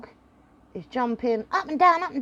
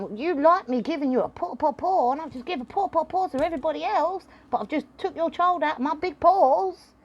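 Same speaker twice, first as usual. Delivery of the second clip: lightly voiced dog; 6 semitones higher, faster than usual.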